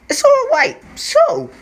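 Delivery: high loud shriek of shock; shrieking disbelief. Real, not acted. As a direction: shout it out in disbelief. Repeats it, nearly shrieking.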